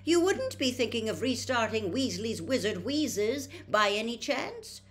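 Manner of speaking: shrewdly. A narrator's reading. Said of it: "said Missus Weasley shrewdly".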